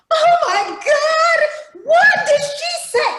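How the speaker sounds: very high, loud and drawn-out sitcom shriek; high, loud mock sitcom panic; sitcom squeal. Shown as a demonstration. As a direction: squeal it like a sitcom character overreacting. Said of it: "she switched to sitcom".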